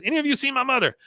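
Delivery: very high-pitched character question; Con. Retold or imitated. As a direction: imitate an agitated son, voice way up. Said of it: "Up walks Con".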